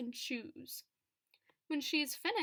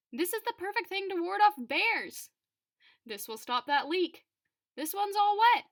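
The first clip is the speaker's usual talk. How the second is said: mostly higher, louder storybook exclamations; lightly voiced delighted admirers; 5 semitones higher, 6 dB louder than usual. Narrator voicing people who find the discarded things, lightly excited. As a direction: lightly act out pleased onlookers calling out.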